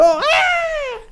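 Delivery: sudden high shriek, far above his voice; woman's scream. Acted. Preacher acting out the medium's scream on seeing the spirit. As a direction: let out a short piercing scream of fright. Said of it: "gritó".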